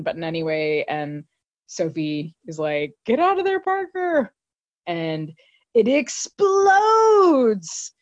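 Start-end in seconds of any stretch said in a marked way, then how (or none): 3.0-4.3 warning call pitched much higher; a retold shout of warning; urgent shout. Voicing Sophie's urgent warning. 5.7-7.9 stretched out slow and much higher, louder; drawn-out dramatic telling; dramatic boom. Acted. Dramatic narration of an explosion.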